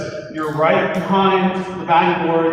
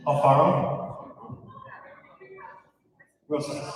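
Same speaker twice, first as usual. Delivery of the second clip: ordinary delivery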